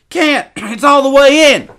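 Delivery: put-on very high, loud boy's wail; pained wail. A narrator's reading. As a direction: cry out hurt and frightened. Hurt boy, hook stuck.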